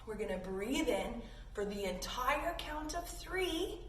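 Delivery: ordinary delivery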